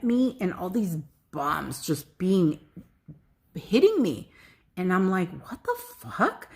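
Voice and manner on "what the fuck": high, hushed disbelief; baffled squeak, kept soft; retold bewilderment. Recounting being hit by sudden grief, bewildered.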